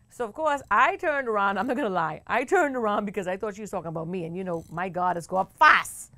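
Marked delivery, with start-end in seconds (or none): none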